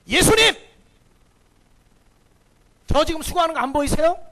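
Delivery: calls out high and loud, aggrieved; indignant outburst. Retold or imitated. Martha confronting Jesus about her work, loud and indignant. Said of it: "그러면서 예수님과 따지는 거예요".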